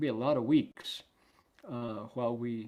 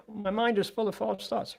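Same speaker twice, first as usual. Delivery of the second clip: put-on high-pitched childlike protest; complaining child; 9 semitones higher, 3 dB louder than usual. Inner child objecting to the parent's order, high mock complaint. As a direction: pitch it way up like a kid making excuses. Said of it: "be like the parent to yourself".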